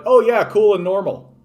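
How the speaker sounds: loud, high sarcastic agreement; imagined European reaction, hammed-up and sarcastic; mock approval. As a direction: play up the phony approval, voice lifted. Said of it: "be like".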